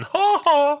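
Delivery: high mocking "ha ha" taunt; Nelson "Ha ha". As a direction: point and jeer like a bully. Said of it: "like a Nelson".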